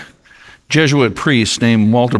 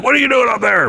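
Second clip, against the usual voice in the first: high, raised shout; king shouting up; 10 semitones higher, much faster than usual. Acted. King shouts up to the shaman on the roof, high demand. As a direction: call up at the roof.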